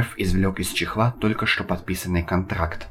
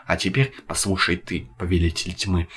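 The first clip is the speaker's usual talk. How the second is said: ordinary delivery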